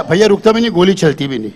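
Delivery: ordinary talk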